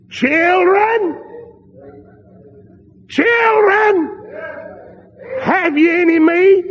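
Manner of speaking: calling across the water from the shore, rising to a shout. Retold or imitated. Jesus calls to fishermen.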